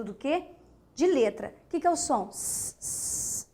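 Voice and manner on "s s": quiet voiceless S, s; s sound. Shown as a demonstration. Teacher showing pupils the S sound again, a soft hiss. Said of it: "isso é som".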